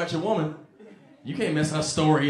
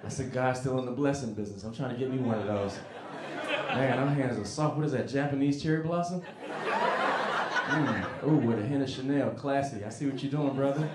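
sleazy charmer; 5 semitones lower, 4 dB quieter than usual. Retold or imitated. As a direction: lay it on thick as the creepy friend. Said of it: "he extra sexy".

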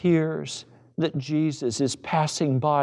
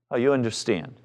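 ordinary talk

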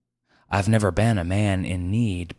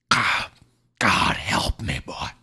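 dying man whispering a prayer; pleading dying man; 2 semitones higher, slower than usual. A narrator's reading. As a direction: beg for help on failing breath.

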